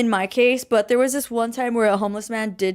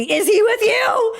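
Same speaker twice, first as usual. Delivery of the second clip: high, slow, drawn-out squeal; mock the question in comic disbelief; mimicked incredulous question; 12 semitones higher, 4 dB louder, slower than usual. Quoting the cashiers' question again, mimicked incredulous question. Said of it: "Because they asked me".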